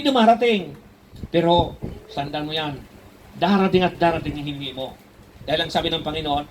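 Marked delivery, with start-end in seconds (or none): none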